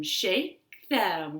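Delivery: ordinary delivery, nothing marked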